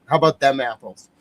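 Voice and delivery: smug triumphant flourish; cocky victory line; triumphant tag. Real, not acted. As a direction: land it like a mic drop. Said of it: "trick finished".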